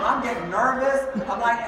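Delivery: ordinary delivery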